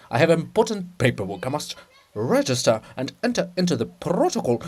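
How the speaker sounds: a hint of a self-important monk; lightly voiced pompous scribe. Cyril brushing off the princess, mildly pompous. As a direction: brush her off with mild pomp.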